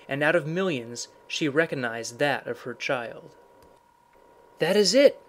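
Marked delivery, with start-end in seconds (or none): 4.6-5.2 sudden loud, high exclamation; raised, louder cry of discovery. A narrator's reading. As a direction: cry out in recognition. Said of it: "she cried".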